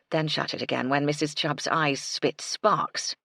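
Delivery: ordinary delivery, nothing marked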